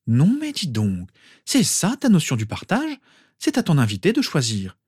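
high-pitched motherly telling-off; indignant scolding. A narrator's reading. Mother to son about sharing, indignant scolding. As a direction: scold with rising disbelief.

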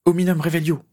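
high, ringing incantation; called-out spell. A narrator's reading. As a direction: cast the spell out high and firm. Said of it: "lança-t-elle".

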